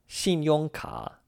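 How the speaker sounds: slow, high-pitched 信用卡, falling on 信 and 用; measured teacher's 信用卡, falling, falling, dipping; two fourths, one third. Shown as a demonstration. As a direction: let 信 and 用 fall, dip on 卡. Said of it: "X I N in the fourth tone".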